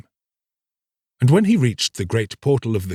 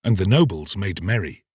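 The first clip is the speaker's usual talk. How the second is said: plain reading voice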